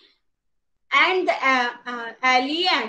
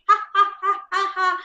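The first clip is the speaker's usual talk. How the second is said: mock laugh; 5 semitones higher, faster than usual. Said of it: "laughing it".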